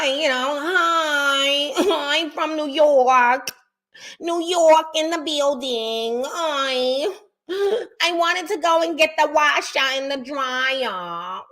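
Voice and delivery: lightly hammed-up New York lady accent; New York accent impression. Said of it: "Y'all know how them people sound".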